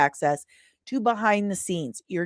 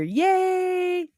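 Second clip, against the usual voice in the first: high, drawn-out sarcastic cheer; mock cheer; 10 semitones higher than usual. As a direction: stretch it out in a silly cheer.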